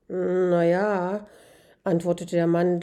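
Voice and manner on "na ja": drawn-out, reluctant admission; hedge, stretching out the words; sheepish, evasive stalling; hesitant. Acted. Man hesitantly admits he hasn't checked.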